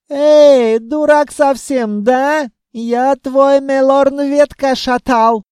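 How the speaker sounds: put-on high, loud Caucasian accent taunt. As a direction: yell across in a Caucasian accent.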